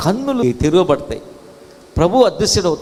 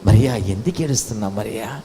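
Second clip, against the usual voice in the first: tender consoling; 9 semitones lower than usual. Acted. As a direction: call gently to someone who is crying. Jesus consoling weeping Mary.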